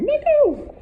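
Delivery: very high, loud call of the name; excited squeal. Shown as a demonstration. Trainer testing the dog's stay, excited squeal. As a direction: squeal to lure the dog.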